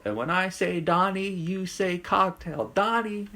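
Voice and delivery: high, slow hype-man call to the crowd; hype call-and-response. Acted. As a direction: work the crowd like a wrestling promo. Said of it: "I do the whole".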